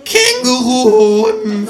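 very high, loud, stretched cry; shocked kangaroo outburst; startled out of trance. A narrator's reading. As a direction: jolt awake and shriek the word. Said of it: "fährt das Känguru aus seiner Trance empor".

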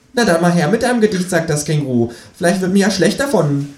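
high, mocking kangaroo. A narrator's reading.

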